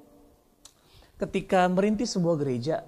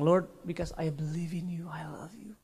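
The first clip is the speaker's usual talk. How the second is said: hushed whisper of prayer; 2 semitones lower, 7 dB quieter than usual. Retold or imitated. Pastor retelling his prayer to God, whispering. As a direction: breathe it barely aloud.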